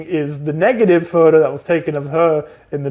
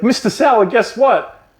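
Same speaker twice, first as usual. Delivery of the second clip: eager call; 7 semitones higher, slower than usual. Retold or imitated. Ryan Gosling bursting into class, eager. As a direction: sing it out proudly. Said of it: "he's like".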